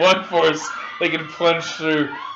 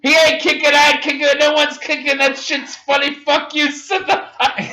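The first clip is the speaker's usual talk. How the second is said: higher mock yelling; 8 semitones higher than usual. Retold yelling at theatergoer.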